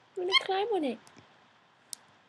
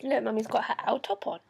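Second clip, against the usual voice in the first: ordinary delivery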